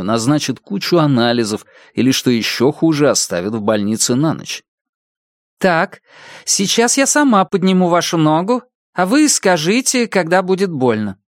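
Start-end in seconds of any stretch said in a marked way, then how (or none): 5.6-11.2 brisk questioning doctor; female doctor voice. Acted.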